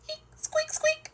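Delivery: very high little squeaks; Richard Rabbit, excited. A narrator's reading. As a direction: squeak eagerly like a tiny animal. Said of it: "says Richard Rabbit, excited to get to try swimming".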